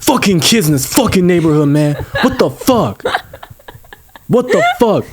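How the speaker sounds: high, laughing mock rant; laughing impression of a furious driver; mocking angry driver. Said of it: "this guy's like".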